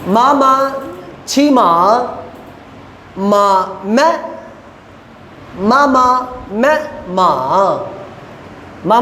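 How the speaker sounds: मा syllables read out in Mandarin tones, rising and falling. Shown as a demonstration. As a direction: model each tone clearly for students. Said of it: "ये speak करने के बाद". (टोन के साथ paragraph पढ़ना)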